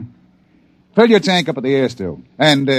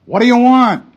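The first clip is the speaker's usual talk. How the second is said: calling out; 10 semitones higher than usual. Acted. Father calls back to strangers.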